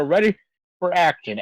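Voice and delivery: ordinary talk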